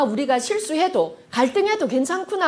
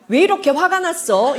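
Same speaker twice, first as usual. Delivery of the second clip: pointed, higher-pitched demand; mock confrontation, pitched up; accusing question; 6 semitones higher, 4 dB louder than usual. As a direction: put them on the spot.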